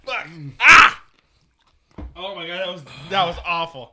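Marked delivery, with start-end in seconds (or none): 0.6-1.0 loud burning cry; pained yell. Real, not acted. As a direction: yell out from the heat. Reaction to hot pickle.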